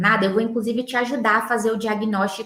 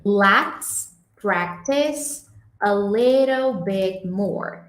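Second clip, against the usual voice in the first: "Let's practice a little bit more" very slowly, "little" like leɾel; slow model phrase; much slower than usual. Shown as a demonstration. Teacher reads the class motto slowly as a model. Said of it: "essa frase aqui".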